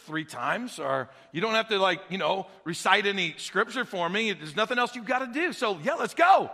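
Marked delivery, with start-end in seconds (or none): none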